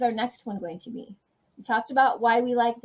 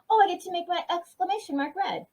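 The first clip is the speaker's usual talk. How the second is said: much higher, delighted; light up with joy; 9 semitones higher than usual. Real, not acted. Teacher delighted to color her exclamation mark red.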